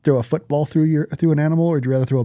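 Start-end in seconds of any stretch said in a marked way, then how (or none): none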